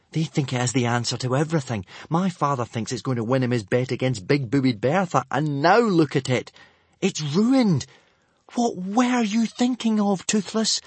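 put-on boy scolding his dragon; exasperated boy. A narrator's reading.

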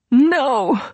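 high panicked cry; terrified scream. Acted. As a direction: cry out in terror. Scream as dirt falls.

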